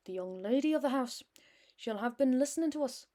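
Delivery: a put-on Irish brogue that rises to a call for Ruth; hammy Irish accent. Landlady calls daughter Ruth to greet guest, hammy Irish accent. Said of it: "I know this accent is hammy as hell".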